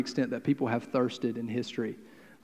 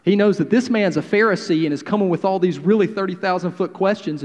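ordinary delivery

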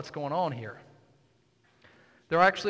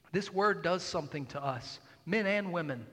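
normal talking voice

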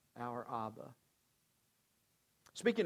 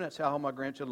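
ordinary talk, nothing marked

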